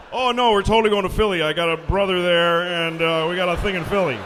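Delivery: loud, quick, breezy fib; fake casual liar. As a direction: brush it off with a lie. Acting out his own lie.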